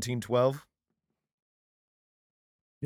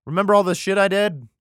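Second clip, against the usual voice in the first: high, loud mock reminder; God calling out; 6 semitones higher, 8 dB louder than usual. God reminding David of past favours, loud calling out. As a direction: call out insistently in a raised voice.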